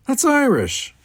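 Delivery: high-pitched, loud scold. A narrator's reading. Kirk correcting Chekhov's accent, scolding. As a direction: tick him off sharply. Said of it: "Kirk scolded".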